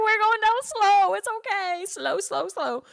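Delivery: very high, strained, half-laughing; mock panicked reassurance. Daughter lowering Mom to the floor, mock panicked reassurance. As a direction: squeal the reassurance, easing down in pitch toward the end.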